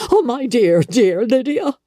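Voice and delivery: high, gushing exclamation; a mother overjoyed at her daughter's news; excited cry. A narrator's reading. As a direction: cry it out in giddy delight. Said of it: "she cried".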